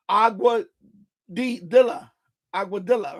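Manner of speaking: agua-di-dilla-Aguadilla, teaching the sounds; syllable by syllable. Shown as a demonstration. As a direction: break Aguadilla into its parts like a teacher. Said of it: "with the Spanish".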